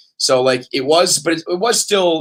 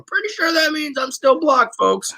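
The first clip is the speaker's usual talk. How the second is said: a real yelp of disbelief; exasperated outburst; 12 semitones higher than usual. Real, not acted. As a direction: throw it out to the listeners in frustration. Exasperated outburst about being blocked.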